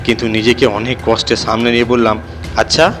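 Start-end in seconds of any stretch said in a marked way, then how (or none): none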